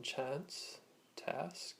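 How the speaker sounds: North American "chance, task" with the short a of cat; North American accent. Shown as a demonstration. Teacher recapping North American vowels, American short a in "chance, task". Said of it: "North American style accent".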